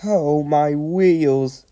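high, slow, shivery wail; little engine wailing with cold; shivering. A narrator's reading. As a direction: moan it drawn out, as if freezing. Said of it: "shivered Percy".

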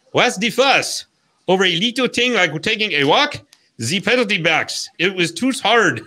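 lightly put-on French-Canadian accent, easygoing shrug; heavy French-Canadian accent. Host voicing the clown's excuse for leaving the penalty box, light French-Canadian accent. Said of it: "in his heavy French-Canadian accent".

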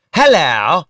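usual speaking voice

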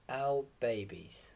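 usual speaking voice

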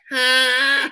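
one sustained blaring note; imitated horn. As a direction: honk like an arena horn. Imitating an NBA horn blast.

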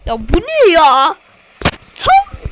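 loud, slow indignant question; exasperated outburst. Real, not acted. Gamer outraged at his teammates, exasperated. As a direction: protest at the game.

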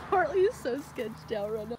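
ordinary talk

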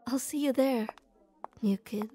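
ordinary talk

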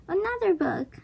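high-pitched storybook outburst; mild roar. A narrator's reading. Captain Bones finds yet another book, mild high roar. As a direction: exclaim it high, without full volume. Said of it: "roared Captain Bones".

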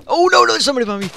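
high, loud, fast panic; fast, high, loud panicked cry. Real, not acted. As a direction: blurt it out in a rush of alarm. Enemy sneaks up in game.

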